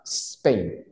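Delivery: SPAIN stretched out slowly with the opening S held; careful, drawn-out "Spain"; model pronunciation. Shown as a demonstration. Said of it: "Ah, Spain".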